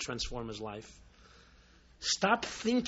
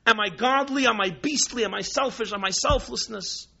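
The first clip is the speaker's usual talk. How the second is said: louder, higher sermon push; fervent preaching; 12 semitones higher, 10 dB louder than usual. Acted. Rabbi preaching to his listeners, loud and fervent. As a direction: drive the questions home to the crowd.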